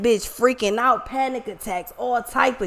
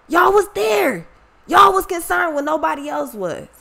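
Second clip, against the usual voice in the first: passionate outcry; 7 semitones higher than usual. Real, not acted. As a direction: pour it out to the fans, voice climbing. Emotional outburst thanking audience.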